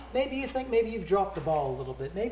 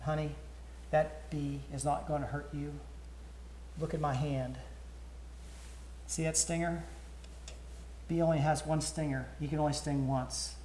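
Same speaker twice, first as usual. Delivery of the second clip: put-on soft, calm father soothing his girl; quietly calm a scared child; gentle dad, voice dropped soft; reassuring father; 7 dB quieter than usual. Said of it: "the father says".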